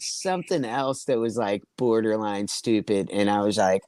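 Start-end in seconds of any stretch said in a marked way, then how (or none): none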